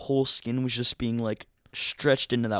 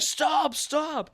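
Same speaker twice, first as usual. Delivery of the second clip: high, raised urgent plea; calling to stop; 3 dB louder than usual. Retold or imitated. Rider begging to stop while dragging feet, calling out high. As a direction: cry out as if being dragged along.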